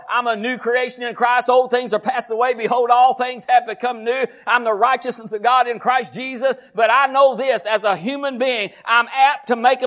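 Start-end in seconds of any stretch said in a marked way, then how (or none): none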